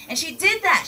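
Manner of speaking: usual speaking voice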